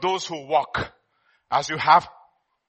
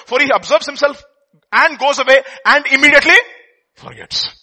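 scripture reading; 4 semitones higher, 7 dB louder than usual. Acted.

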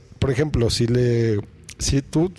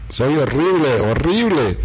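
loud distorted overdriven voice; harsh saturated loud voice; saturated distortion; 4 dB louder than usual. Shown as a demonstration. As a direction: overdrive the mic until it clips. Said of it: "se va a escuchar súper saturado".

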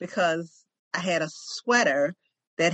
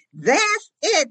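excited realization; 10 semitones higher, 5 dB louder than usual. Retold or imitated. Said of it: "I'm like".

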